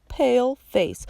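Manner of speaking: "pale face" echoed high and sneering; mocking echo. A narrator's reading. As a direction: throw "pale face" back at him with a sneer. Stefano mocking Klaus's words.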